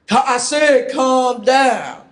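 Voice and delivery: loud, insistent order. Acted. Partner orders the other to calm down, loud insistence. Acted quarrel, louder repeat.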